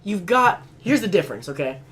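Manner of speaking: loud, high worked-up rant; heated rant. Real, not acted. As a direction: argue the point hotly.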